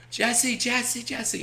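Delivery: high-pitched imitation of a kid calling out; eager kid. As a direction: mimic a child calling for attention. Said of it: "I remember this, this kid".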